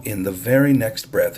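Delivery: ordinary delivery, nothing marked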